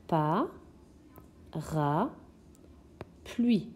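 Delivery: "Pa, ra, pluie" in isolated syllables with long pauses; découpage syllabique. Shown as a demonstration. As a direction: stretch and lift "Pa" and "ra", then drop on "pluie". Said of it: "On entend la syllabe ra ici". (« Pa, ra, pluie » syllabe par syllabe, bien séparées)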